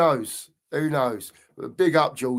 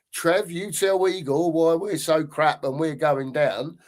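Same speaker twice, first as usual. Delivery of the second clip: ordinary talk, nothing marked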